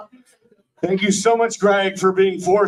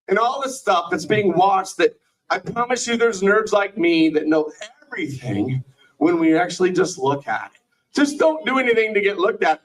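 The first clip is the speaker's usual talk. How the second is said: ordinary talk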